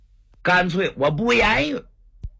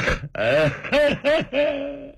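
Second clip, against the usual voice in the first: high drawn-out howl of weeping; loud wailing sob; 10 semitones higher than usual. Acted. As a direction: wail with no words. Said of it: "嚎啕大哭". (嚎啕大哭的哭声)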